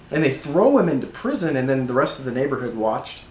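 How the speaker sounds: ordinary talk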